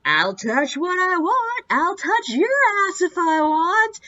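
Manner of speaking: loud, high, drawling drunk imitation; mock a belligerent drunk, stretching the words upward; drunk guy. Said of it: "this drunk guy turns and looks".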